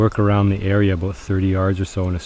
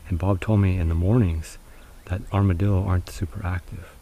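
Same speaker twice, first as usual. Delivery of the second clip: ordinary delivery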